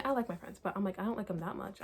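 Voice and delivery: ordinary delivery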